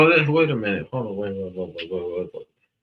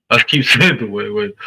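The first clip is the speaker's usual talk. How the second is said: laughing at himself; 8 dB louder than usual. Real, not acted. Laughing at his own slip.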